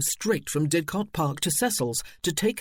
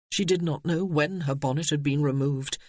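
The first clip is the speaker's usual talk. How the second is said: ordinary delivery, nothing marked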